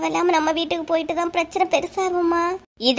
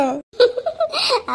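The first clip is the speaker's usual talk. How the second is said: long, high giggling; high-pitched peal of laughter; mocking laugh; 8 semitones higher than usual. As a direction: keep laughing despite the reproach. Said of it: "உங்களுக்கு எல்லாம் சிரிப்பா இருக்கு".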